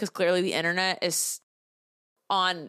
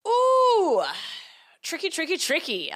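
high drawn-out ooh, then a teasing tricky; intrigued ooh; 8 semitones higher than usual. Real, not acted. Reacting to a new Reddit post, high intrigued ooh. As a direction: savour the dilemma, pitch up high.

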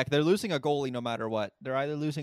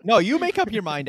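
loud, raised-pitch comeback; heated retort; 7 semitones higher, 8 dB louder than usual. Real, not acted. Host turning the complaint back on his guest, heated retort. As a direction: throw it straight back at them, higher and louder.

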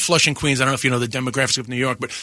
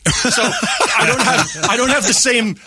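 laughing; 7 semitones higher, much slower than usual. Real, not acted. Laughing at the dad comparison.

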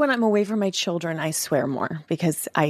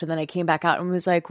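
normal talking voice

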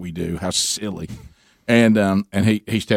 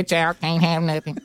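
high, slow robotic call; mock robot line judge scolding; robot umpire voice; 8 semitones higher than usual. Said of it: "what if Gary did the one".

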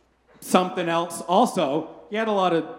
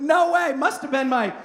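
much higher, louder frantic denial; panicked Peter; 8 semitones higher, 4 dB louder than usual. Retold or imitated. Peter frantically denying he knows Jesus to a bystander. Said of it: "And Peter's like".

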